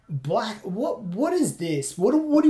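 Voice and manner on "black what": ordinary talk, nothing marked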